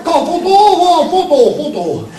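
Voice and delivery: high, drawn-out cooing chant; versi da piccioni. A narrator's reading. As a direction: coo the word like a pigeon. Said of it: "i due cominciarono a dire". (cantilena acuta da piccione)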